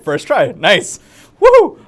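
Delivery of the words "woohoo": much higher and louder cheer; excited whoop. Real, not acted. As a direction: whoop for joy. Celebrating stream working first try.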